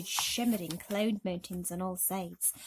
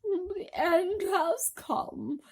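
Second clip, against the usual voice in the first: much higher, very slow cry; despairing wail; 11 semitones higher, 4 dB louder, much slower than usual. A narrator's reading. Miss Spider wailing to the others in despair. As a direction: mourn the end.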